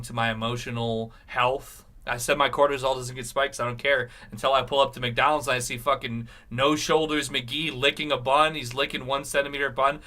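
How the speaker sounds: ordinary talk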